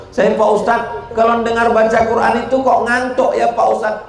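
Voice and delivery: high-pitched mock questioner; puzzled congregant. Congregant asking the ustadz about sleepiness, high puzzled voice. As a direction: lightly play a congregant asking, pitched well up.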